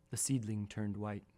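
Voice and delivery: ordinary delivery, nothing marked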